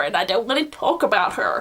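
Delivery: usual speaking voice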